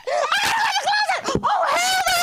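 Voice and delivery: very high, fast hammed-up shriek; high comic panic shout; panicked shriek. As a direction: scream in mock terror. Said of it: "I said".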